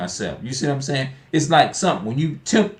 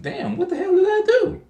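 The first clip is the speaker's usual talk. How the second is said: much higher squeaky mock disbelief; baffled squeak. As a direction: squeal it, half laughing and baffled. Retelling stares from strangers.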